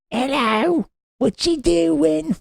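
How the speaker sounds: very high, slow, silly British voice; mock British accent. Britain checking up on America, high mock British accent. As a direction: squeak out a needy British caller. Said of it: "what accent was that?".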